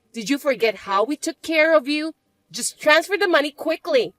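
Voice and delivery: a spoiled stepsister barking demands; bratty stepsister. Acted. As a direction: stepsister sneering at her older sister.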